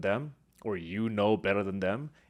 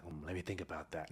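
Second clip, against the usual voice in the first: quiet, musing to oneself; as if weighing a question; pensive murmur; 5 semitones lower, 14 dB quieter than usual. Retold or imitated. Audience member reflecting after the speech, quiet and musing. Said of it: "And they're like".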